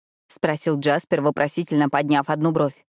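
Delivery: ordinary delivery, nothing marked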